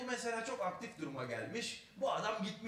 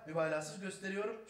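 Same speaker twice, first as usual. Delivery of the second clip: ordinary talk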